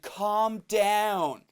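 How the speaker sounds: drawn-out, exasperated mock complaint; imitated annoyed woman. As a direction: stretch it out, hammed-up irritation. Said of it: "she's so annoyed".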